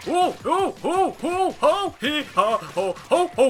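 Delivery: booming high Santa laugh; jolly ho ho ho. Pretend. Christmas talk, Santa laugh.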